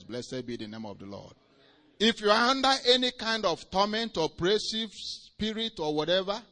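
loud, rising preacher's cry, stretched on erase; preaching at full force. Acted. Preacher urging the congregation, preaching at full force. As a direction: thunder it from the pulpit.

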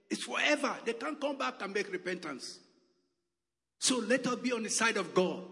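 high-pitched warning from the pulpit; urgent preaching. Real, not acted. Preacher warning people there is no coming back, urgent preaching.

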